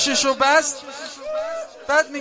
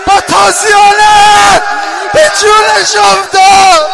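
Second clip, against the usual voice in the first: loud tearful preaching, rising higher; loud crying climax of the lament; weeping preaching; 4 semitones higher, 11 dB louder than usual. Real, not acted. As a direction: preach at full force through tears.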